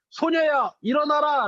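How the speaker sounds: put-on Jesus calling the girl to rise, firm command. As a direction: raise her with a clear command. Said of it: "라고 하면서 손을 잡고 이렇게 세우셨어요".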